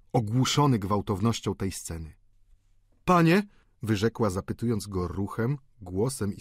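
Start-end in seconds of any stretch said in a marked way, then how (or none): 3.0-3.4 high, loud questioning call; urgent woman's plea in one word; appealing countess. A narrator's reading.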